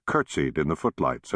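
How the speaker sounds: usual speaking voice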